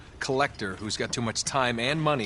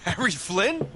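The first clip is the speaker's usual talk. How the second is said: pitched up in surprise, calling out Hey; surprised greeting; 13 semitones higher, slower than usual. Acted. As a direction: caught off guard by an old partner, half glad. Unexpected reunion with old partner.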